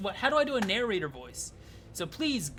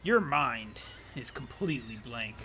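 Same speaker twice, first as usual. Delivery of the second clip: slow, grand narrator questions; hammed-up storybook narrator; dramatic narrator; 3 semitones lower, slower than usual. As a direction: pose the mystery like a theatrical narrator.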